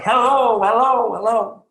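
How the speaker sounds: loud, high, long calls fading on the last; echoing hello shouts, each a bit weaker; hollering across a valley. Retold or imitated. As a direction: call out to the far side of the valley. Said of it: "the guy hollers across the valley".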